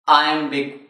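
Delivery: ordinary talk, nothing marked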